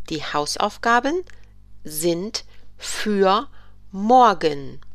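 repeated in separate pieces; chunked repeat. Shown as a demonstration. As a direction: break it into parts. German teacher repeats the sentence.

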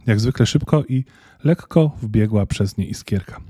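ordinary delivery

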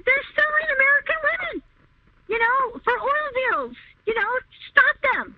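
begging the cop to act, voice climbing high; frantic plea. Retold or imitated. Caller retelling her plea to the cop, frantic and high.

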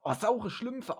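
ordinary talk

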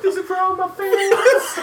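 mock speech through laughter; laughing mock speech.

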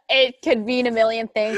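ordinary delivery

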